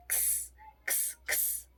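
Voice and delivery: क्स as a voiceless "ks"; whispered click into hiss for क्स, no vowel; k and s together. Shown as a demonstration. Teacher demonstrating the X sound, क्स as bare "ks". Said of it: "क और स एक साथ".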